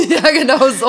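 loud high laughter; laughing loudly. Real, not acted. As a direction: burst out laughing. Bursting out laughing.

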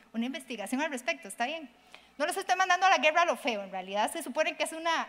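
normal talking voice

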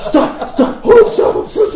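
high, loud cries of stop; hammed-up girl's frantic stop; mock panicked plea. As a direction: yell stop like she's scared. Acted plea in story.

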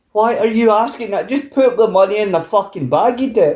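retold exasperation, pitched well up; high, incredulous telling-off of an idiot; exasperated. Exasperated reaction to shop clerk.

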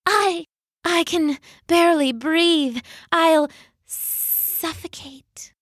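high, hammed-up gasping, fading to a whisper; dramatic adder. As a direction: overplay the suffocation. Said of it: "coughed the adder dramatically".

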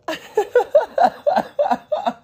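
high laugh breaking into coughing. Real, not acted. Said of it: "did you notice when I laughed, it turned into a cough".

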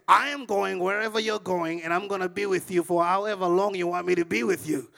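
mock the girl's forceful insistence, loudly; insistent reply. Girl tells the driver she is staying, loudly insistent.

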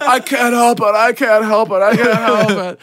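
high whiny plea; hammed-up drunk whimper; drunk whining. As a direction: whine it like a sick drunk. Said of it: "I was like".

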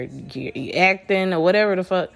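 ordinary talk

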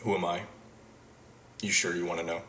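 low, quiet Don Draper voice; Don Draper impression. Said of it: "do it as Don Draper".

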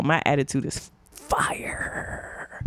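low, softened, drawn-out swagger; hushed boast. Pretend. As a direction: draw it out in a breathed hush. Boasting of fierce attitude.

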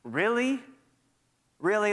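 mildly taunting disbelief; lightly voiced skeptical accuser; accusing voice, skeptical. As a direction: question it as a doubting accuser. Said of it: "that old accusing voice creeps back in".

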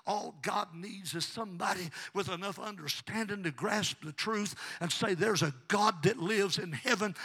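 loud preaching building to a shout; full-force preaching. Real, not acted. Preacher rousing the congregation, building to full force.

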